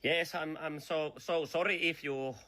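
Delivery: ordinary talk, nothing marked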